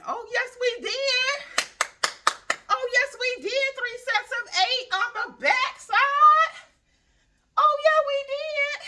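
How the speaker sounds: high, loud, slowed victory call; triumphant cheer. Real, not acted. To viewers after the last set, a high loud slow cheer. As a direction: crow it out like a win. Celebrating finishing the sets.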